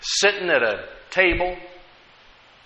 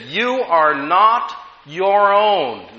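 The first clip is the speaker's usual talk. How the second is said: drawn out slowly, word by word; slow emphatic verse; 3 semitones higher, 3 dB louder, much slower than usual. Acted. Preacher reading the verse slowly to the congregation. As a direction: let each word land slowly.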